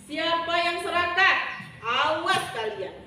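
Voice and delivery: loud, indignant storybook villain; angry. Acted. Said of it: "Bujang beji marah dan malu". (marah mengancam dengan suara keras)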